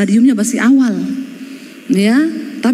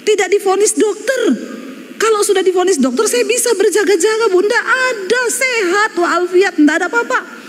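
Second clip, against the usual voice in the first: higher-voiced widow insisting he was healthy; widow's reply; 9 semitones higher than usual. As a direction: lightly play the surprised widow. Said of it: "Saya tanya".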